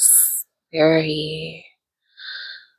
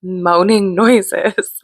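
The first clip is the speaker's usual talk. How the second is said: slow drawn-out; 2 semitones higher, much slower than usual. Retold or imitated. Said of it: "she was like".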